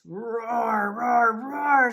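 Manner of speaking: big, loud, rising roars; lion roaring. A narrator's reading. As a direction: roar like a storybook lion. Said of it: "said the lions".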